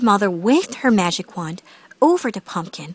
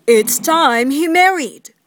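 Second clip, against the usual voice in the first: slow, higher declaration; declaring king; 5 semitones higher, 4 dB louder, slower than usual. A narrator's reading. The king to the Grand Duke about the prince, declaring slowly. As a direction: draw it out with royal emphasis.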